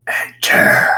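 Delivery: rasping "Enter" from inside the cabin; raspy voice. Acted. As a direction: rasp it out, all breath and no tone. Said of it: "the sound of the raspy voice".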